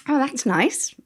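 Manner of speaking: a lightly mocking impression of the casual viewer; mock naive approval. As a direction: imitate someone shrugging it off as nice. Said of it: "they see something and they go".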